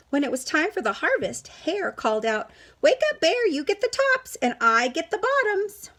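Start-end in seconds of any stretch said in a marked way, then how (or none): 2.8-5.9 high, loud call. Acted. Hare calls loudly to wake Bear for harvest.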